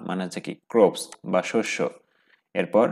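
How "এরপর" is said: ordinary delivery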